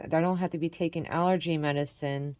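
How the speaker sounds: ordinary talk, nothing marked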